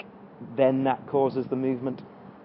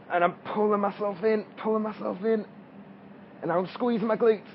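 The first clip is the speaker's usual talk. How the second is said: straining mid-squat; 6 semitones higher than usual. Real, not acted. Talking mid-squat under effort.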